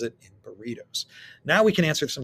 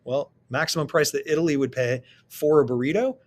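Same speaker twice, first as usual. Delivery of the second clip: usual speaking voice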